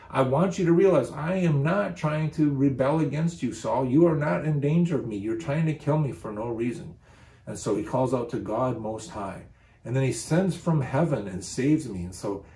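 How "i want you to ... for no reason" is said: higher, louder protest of innocence; emphatic protest. Acted. David telling Saul he is no rebel, emphatic protest. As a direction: plead your innocence insistently, pitched up.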